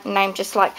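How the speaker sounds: ordinary talk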